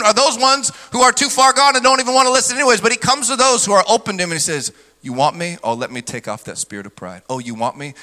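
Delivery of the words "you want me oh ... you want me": much softer, lower, gentle invitation; tender voice of God. Retold or imitated. God inviting the open-hearted, soft and tender. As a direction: coax them gently.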